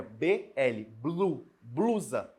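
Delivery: "B L, blu, blusa" built up slowly from letters; letters first, then "blu", then the whole "blusa"; sounding out blusa. Shown as a demonstration. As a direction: spell "B L" then blend into "blusa", slow and careful. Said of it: "Percebam que sai o som do B e do L".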